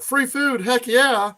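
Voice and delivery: high, excited grab at free food; eager coworkers. Retold or imitated. Coworkers cheer for free peppers, high and eager. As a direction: squeal with delight at free stuff.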